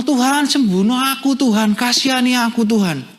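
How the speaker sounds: high-pitched plea, lightly acted; blind man pleading. Preacher acting the blind man begging God to heal him, pleading. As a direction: beg God for healing, falling away at the end. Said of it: "Si orang buta ini ngomong".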